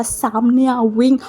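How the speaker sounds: slow, lightly deepened stern command; a hint of menace, slowly; grave voice. A narrator's reading. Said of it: "गंभीर voice में बोला". (हल्की गंभीर आवाज़ में धीमा आदेश)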